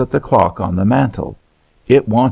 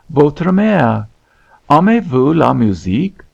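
put-on higher-pitched child reciting stock French phrases; voluble schoolgirl French; 5 semitones higher than usual. A narrator's reading. Hester showing off French to Miss Morland, high recited tirade. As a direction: rattle off each French question like a proud pupil. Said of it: "uttered with great volubility".

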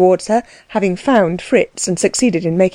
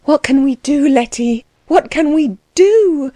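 distressed cry; 7 semitones higher than usual. A narrator's reading. Anna to Letty as the stables burn, distressed cry. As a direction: build to a long, despairing DO. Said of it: "cried Anna".

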